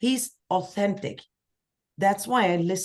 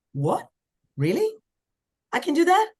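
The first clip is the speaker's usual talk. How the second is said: stunned disbelief; 10 semitones higher than usual. Retold or imitated. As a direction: sound like you can't believe your luck. Retelling her stunned reaction to offer.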